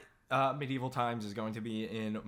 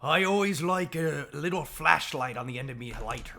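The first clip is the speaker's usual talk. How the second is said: a hint of an Irish accent; 2 semitones higher, 4 dB louder than usual. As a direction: say it in a light Irish lilt. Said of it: "What an incredible accent!".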